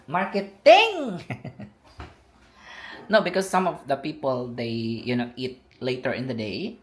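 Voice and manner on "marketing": loud, high, slow exclaim; excited echo. Real, not acted. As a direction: stretch the word out loudly. Echoing partner's word, slowed.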